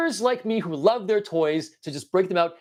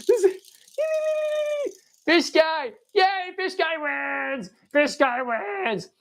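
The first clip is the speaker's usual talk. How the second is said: high, long-drawn winner's announcement; mock victory cheer; 11 semitones higher, much slower than usual. Toy player declaring fish guy the winner, drawn-out cheer. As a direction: stretch out each word in a high cheer.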